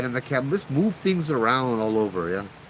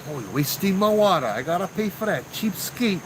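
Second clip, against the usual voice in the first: mock grumbling, pitched up; indignant uncle; 8 semitones higher than usual. Uncle fumes over ghost wasting his water, mock outraged. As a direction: work up a comic indignation.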